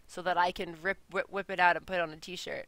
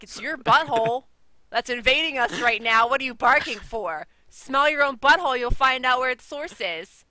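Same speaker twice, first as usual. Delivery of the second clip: louder, higher cartoon dog lecturing; scolding dog voice; 4 semitones higher, 6 dB louder than usual. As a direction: snap at the barking dog in character. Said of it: "It's like".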